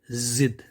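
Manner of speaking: जिद begun with a strong buzzed Z; dotted Z sound. Shown as a demonstration. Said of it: "उसकी जो pronunciation है".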